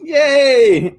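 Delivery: excited cheer. Real, not acted. GM cheers at player's roll.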